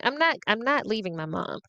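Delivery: normal talking voice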